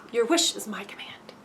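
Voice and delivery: a soft, unvoiced whisper of the universe granting a wish; whispered universe reply. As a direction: breathe it like a genie granting the wish. Universe answering, voiced reply.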